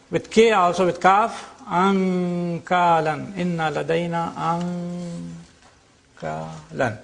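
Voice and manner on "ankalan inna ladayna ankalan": slow "ankalan" with a long ng before k; ikhfa. Shown as a demonstration. Teacher reciting an example with kaf, drawn-out ng in "ankalan". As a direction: hold the hidden n in "ankalan" where k is made. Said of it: "This is called al-ikhfa".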